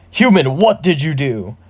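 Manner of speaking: loud, indignant orc outcry; outraged orc shaman. Acted. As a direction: demand it like an appalled orc.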